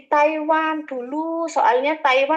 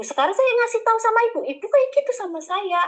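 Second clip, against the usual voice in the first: rapid complaint; 8 semitones higher, faster than usual. Retold or imitated. Retold quarrel with agent.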